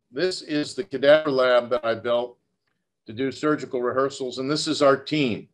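ordinary delivery

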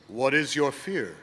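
lightly voiced old sage; wise old sage. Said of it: "asked the wise man".

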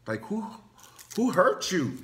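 high, loud, fired-up list of weapons; comic battle-ready rant; hyped-up threat. Pretend. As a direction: rattle off weapons ready to brawl. Ready to fight for cousin.

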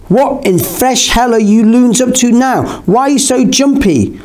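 high-pitched, incredulous needling; lightly voiced teasing George. A narrator's reading. George questions the two jumpy friends, high teasing incredulity. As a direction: pitch it up, mildly exasperated. George startles them, acted character voice.

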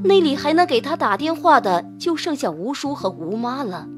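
plain reading voice

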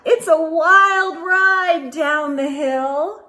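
put-on lively read-aloud to children; storybook narration. Reading a snowman story to children, lively narration. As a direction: tell it like a bedtime picture book. Reads picture book aloud.

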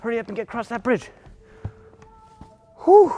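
high, very fast urging; quick, high-pitched hurry-up; urgent hurrying. Pretend. Urging children across the bridge.

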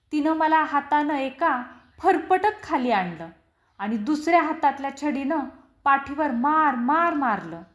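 ordinary delivery

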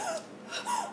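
quick imitated gasp of fright; imitated startled gasp. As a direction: catch your breath in alarm. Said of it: "and I was like".

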